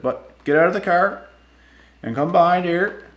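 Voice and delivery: doing the cop telling him to step out; mock cop order.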